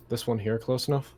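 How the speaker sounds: ordinary talk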